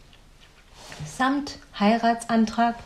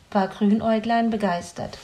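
ordinary delivery, nothing marked

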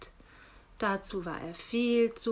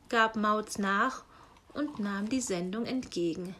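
plain reading voice

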